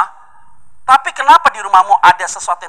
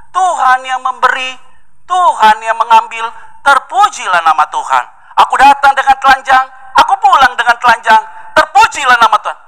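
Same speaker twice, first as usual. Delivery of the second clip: solemn praise; 7 semitones higher, 3 dB louder than usual. Acted. Job's solemn praise quoted.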